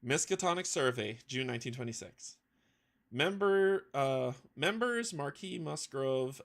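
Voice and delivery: ordinary delivery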